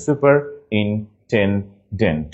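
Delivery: slow "superintendent": super, in, ten, dent; broken into syllables. Shown as a demonstration. Teacher making spelling clear, "superintendent" slowly in pieces. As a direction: pause between each piece of "superintendent". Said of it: "ভেঙে নিলে clear হয়ে যাবে".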